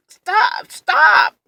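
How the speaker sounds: high, urgent retold cry to stop; pained pleading. Acts out begging dentist to stop.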